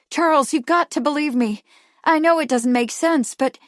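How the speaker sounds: high, loud insistent pleading; urgent plea. A narrator's reading. Kate urgently begs to be believed.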